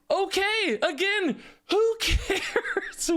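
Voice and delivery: high, exasperated who cares. Real, not acted. Streamer ranting at YouTube commenters, exasperated. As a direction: throw up your hands in disbelief.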